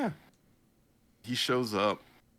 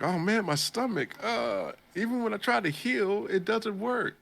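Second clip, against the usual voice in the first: high, whiny complaining about a stomach ache; mock sick groaning; 13 semitones higher, 4 dB louder than usual. The man moaning about poisoned water, high whine. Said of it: "the man's like".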